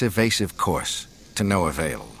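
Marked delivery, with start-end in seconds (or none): none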